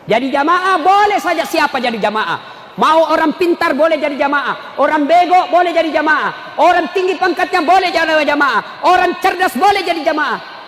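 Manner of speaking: rhythmic, projected oratory; full-force preaching. Acted. Preacher listing who may join the congregation, full-force preaching. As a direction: hammer out the list to the crowd.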